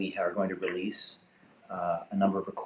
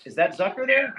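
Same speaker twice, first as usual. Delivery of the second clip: high raised call into the line; 10 semitones higher, 4 dB louder than usual. Real, not acted. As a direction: call out to catch him. Calling out on the line.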